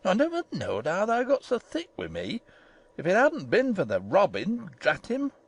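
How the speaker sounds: put-on swooping, indignant old Yorkshire gardener; haranguing. A narrator's reading. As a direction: rant in thick dialect, pitch rising and plunging. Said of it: "he harangued".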